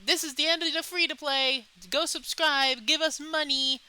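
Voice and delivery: pushy game company voice. Retold or imitated. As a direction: act the greedy game telling you to pay. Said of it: "most games would just say".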